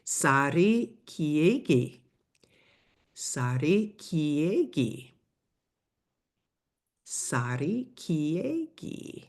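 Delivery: "Sari Kiegi" sounded out very slowly, syllable by syllable; slow teacher's model of "Sari Kiegi"; careful pronunciation. Shown as a demonstration. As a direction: stretch out each part of "Sari Kiegi". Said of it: "How to pronounce the town of".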